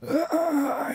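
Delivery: high, strained mock groan; acted high-pitched groan of pain. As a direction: groan like a beaten man in a joke. Beaten man groans in joke.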